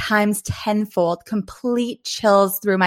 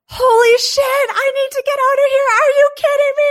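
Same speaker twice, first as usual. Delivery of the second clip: panicked, high-pitched loud scream; freaked-out shriek; 5 dB louder than usual. Retold or imitated. Telling how she freaked out at the carved words, panicked high scream. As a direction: shriek it in terror, voice cracking high. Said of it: "I freaked out".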